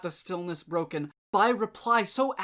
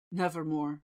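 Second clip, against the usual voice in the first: low, soft, dark answer; raven's reply; 5 semitones lower, 4 dB quieter than usual. A narrator's reading. Raven answering the pleading man, low and soft. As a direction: let the bird deny him coldly. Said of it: "Quoth the raven".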